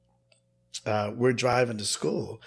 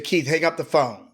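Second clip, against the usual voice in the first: firm, louder and higher command; stern tone; 5 semitones higher, 6 dB louder than usual. Retold or imitated. Aunt ordering her nephew off the landline, stern and louder. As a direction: order him off the line sharply. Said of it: "And just the tone".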